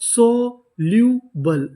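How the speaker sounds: Soluble drawn out very slowly, syllable by syllable; slow demo. Shown as a demonstration. Teacher sounding out soluble slowly for the class. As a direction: stretch each sound of soluble for students. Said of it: "तो हमारी इतनी meaning क्या बनी?".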